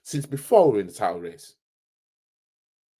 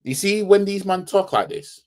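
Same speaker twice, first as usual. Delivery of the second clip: normal talking voice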